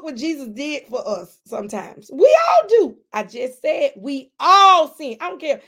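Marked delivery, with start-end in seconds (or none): 2.1-3.0 loud, high tearful shout; crying out. Real, not acted. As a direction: break into a sobbing cry. Preacher overcome mid-sermon.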